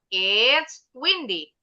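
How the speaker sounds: slow, clear model sentence for the class. Shown as a demonstration. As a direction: spell each word out slowly for pupils. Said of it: "Dari, dari it's-nya dulu".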